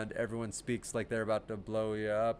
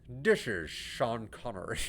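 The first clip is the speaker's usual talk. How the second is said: slow, bad Scottish impression with slushy s; badly faked Scottish star, drawn out; Sean Connery; slower than usual. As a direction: turn each s into sh, slowly. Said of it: "I can't do a good Sean Connery".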